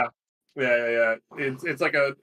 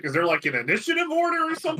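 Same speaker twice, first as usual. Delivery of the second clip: confused question; 14 semitones higher, faster than usual. Retold or imitated. Retold question to the DM.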